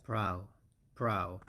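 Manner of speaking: brow said low and falling; second syllable drill. Shown as a demonstration. Teacher isolates brow for the class, falling. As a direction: drop down onto brow. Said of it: "and then it's".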